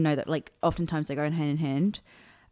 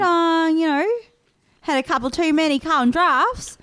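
high, loud hammed-up bogan Australian; bogan Australian accent; 9 semitones higher, 4 dB louder than usual. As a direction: gossip in a broad mock-Aussie bogan accent. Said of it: "like, a bogan Australian".

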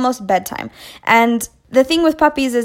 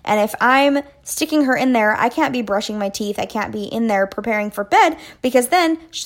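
ordinary delivery, nothing marked

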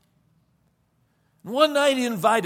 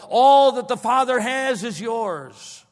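higher, louder, slow proclamation; preaching emphasis; 6 semitones higher, 6 dB louder, slower than usual. Real, not acted.

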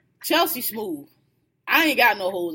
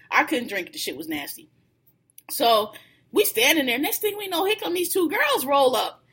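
usual speaking voice

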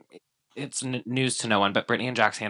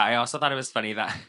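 ordinary talk, nothing marked

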